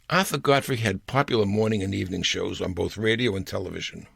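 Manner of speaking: plain reading voice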